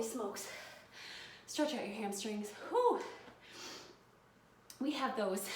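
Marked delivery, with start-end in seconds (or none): 2.7-3.0 high, loud puff of exertion; sharp exhaled whew. Real, not acted. Trainer stretching after jump lunges, sharp whew. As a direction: blow out hard after effort.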